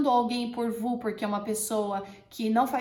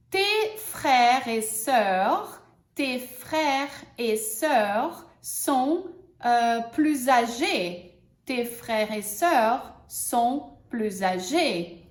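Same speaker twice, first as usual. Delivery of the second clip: very slow, clear example; teacher models slowly; very slow model sentence; much slower than usual. Shown as a demonstration. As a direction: stretch every word. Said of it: "só pra gente treinar".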